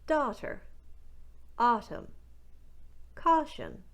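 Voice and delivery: Newfoundland "Thaw", "bought", "daughter" with a wide ah; Newfoundland accent. Shown as a demonstration. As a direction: drill words in a Newfoundland accent. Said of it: "Let's try some more".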